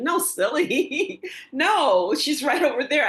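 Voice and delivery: high, loud, drawn-out teasing correction; amused, loudly insistent retort; playful exasperation. Retold or imitated. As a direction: tease them with a big, high "no". Acting out organizer teasing her.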